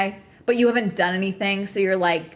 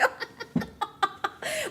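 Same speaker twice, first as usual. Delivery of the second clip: high, soft laughing fit; 13 semitones higher, 10 dB quieter than usual. Real, not acted. Streamer laughing at getting mad over business, soft high giggles. Laughs at own petty anger.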